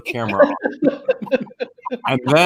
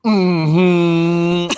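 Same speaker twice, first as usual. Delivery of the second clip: loud, skeptical mm-hmm; unconvinced and mad; annoyed mm-hmm; 4 semitones higher, 6 dB louder than usual. Retold or imitated. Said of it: "she does when she's mad at me".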